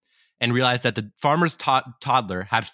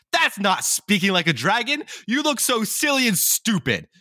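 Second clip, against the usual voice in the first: much higher, sneering taunt in a put-on voice; mocking dogs; 10 semitones higher than usual. Other dogs taunting the would-be dragon, high mocking sneer. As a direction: jeer at him like a schoolyard bully, high and nasty.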